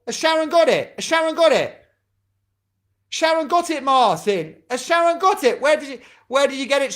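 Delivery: high-pitched, excited checking. Real, not acted. Host checking whether Sharon got it, excited. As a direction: ask eagerly, pitched well up. Checking if a player has guessed.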